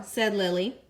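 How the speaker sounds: usual speaking voice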